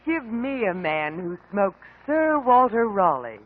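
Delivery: ordinary talk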